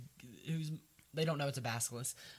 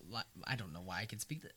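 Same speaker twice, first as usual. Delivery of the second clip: fast worried mumble; 6 semitones lower, 6 dB quieter, faster than usual. Retold or imitated. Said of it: "he's like".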